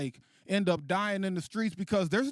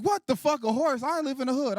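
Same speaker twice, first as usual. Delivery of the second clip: high, raised comic protest; incredulous outrage; 7 semitones higher, 3 dB louder than usual. Acted. Son reacting to dad's cowboy advice, incredulous outrage. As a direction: protest in high-pitched disbelief.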